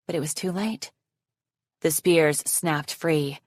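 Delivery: plain reading voice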